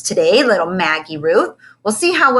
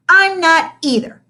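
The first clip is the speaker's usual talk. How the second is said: dramatic sigh; 9 semitones higher, 6 dB louder than usual. A narrator's reading. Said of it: "with a dramatic sigh".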